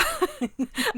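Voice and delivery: high, loud squeal of laughter; grossed-out laugh. Real, not acted. Co-host grossed out by the gill, squealing laugh. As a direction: laugh high through the disgust.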